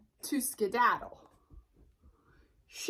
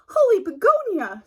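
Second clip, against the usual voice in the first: high, loud startled cry; startled exclamation; 9 semitones higher, 11 dB louder than usual. Acted. Sheep bumps into the hairy stranger, startled high cry. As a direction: yelp it in surprise. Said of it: "she said".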